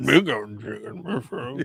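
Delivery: slow, laughing monster growl; mock Jabba echo, laughing.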